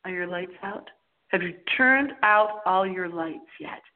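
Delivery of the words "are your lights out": ordinary talk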